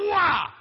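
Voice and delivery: high, loud mock karate yell; mock battle cry. Father imitating his kicking son, loud mock yell. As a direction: burst out a kid's fighting cry.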